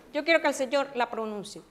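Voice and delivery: ordinary talk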